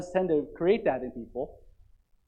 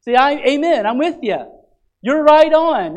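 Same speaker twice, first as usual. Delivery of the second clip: higher, louder amen-style shout of support; congregant calling out; 5 semitones higher, 6 dB louder than usual. Retold or imitated. Churchgoer backing the preacher, loud high call-out. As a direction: call back to the pulpit in agreement. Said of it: "they're helping the preacher to know this".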